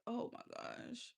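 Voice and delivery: slow whispered groan; whispered dread. Real, not acted. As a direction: breathe it out, dreading. Dread about diet.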